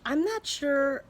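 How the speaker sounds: ordinary talk, nothing marked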